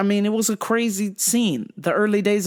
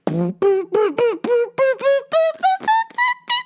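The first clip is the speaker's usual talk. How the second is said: imitated electronic beeps. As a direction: mouth a rising synth line. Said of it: "when it was a fucking electronic song".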